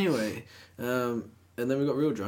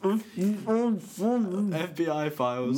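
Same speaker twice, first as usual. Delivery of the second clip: mouth full; 7 semitones higher, much slower than usual. Pretend. Mocking mouth-full reading.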